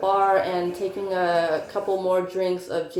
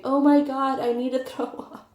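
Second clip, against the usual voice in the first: mock scream; 6 semitones higher than usual. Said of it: "woke up screaming".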